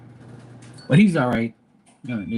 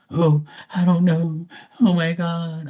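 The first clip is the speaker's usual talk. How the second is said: worried mom voice; 5 semitones higher, slower than usual. Retold or imitated. Mom's worried reply quoted.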